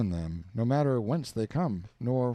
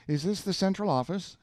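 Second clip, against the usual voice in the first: voiced caller; 8 semitones higher than usual. Narrator asks phone operator.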